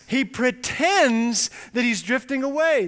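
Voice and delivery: ordinary delivery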